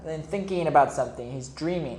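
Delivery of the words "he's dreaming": usual speaking voice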